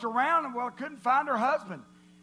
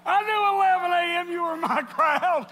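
loud, high-pitched crowing; real exultant shout; 6 semitones higher, 7 dB louder than usual. Real, not acted. Preacher telling eleven A M they are his crowd, shouting.

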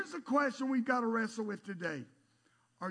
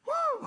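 loud, high "woo"; high whoop; 8 dB louder than usual. Real, not acted. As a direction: whoop it out loudly. Joking about keeping up.

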